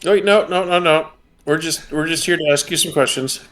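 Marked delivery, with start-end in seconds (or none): none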